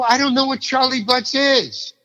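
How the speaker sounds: very high-pitched protest; exasperated bafflement. Retold or imitated. Actor offered Charlie Butts part, baffled and exasperated. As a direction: plead total ignorance, voice way up. Retold helpless answer to director.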